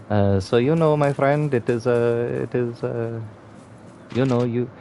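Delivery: mock-stern Indian accent telling off a rude friend. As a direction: play it as an indignant Indian man in an Indian accent. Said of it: "the Indian one".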